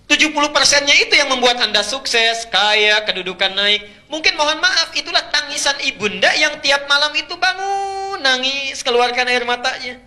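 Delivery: suara tinggi dan lantang, penuh desakan; khotbah berapi-api. Acted. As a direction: berkhotbah lantang dengan kata akhir dipanjangkan. (seruan khotbah bernada tinggi)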